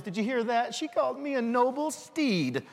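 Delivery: a pleased sidekick who can't believe the compliment; excited Donkey. Retold or imitated. Preacher telling kids Donkey's thrilled reply. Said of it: "And Donkey says".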